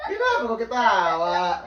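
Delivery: loud laughing question. Real, not acted. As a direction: ask it through laughter. Bertanya sambil tertawa. (bertanya sambil tertawa)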